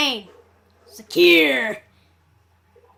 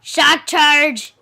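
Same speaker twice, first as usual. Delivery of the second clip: loud battle cry; 11 dB louder, much slower than usual. Shown as a demonstration. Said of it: "I like how I say that".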